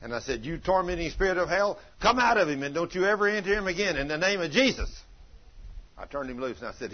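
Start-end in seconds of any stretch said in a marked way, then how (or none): 0.4-4.9 loud, high commanding rebuke; thundering exorcism command. Retold or imitated. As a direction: cast it out with full force. Rebuking a demon from the child.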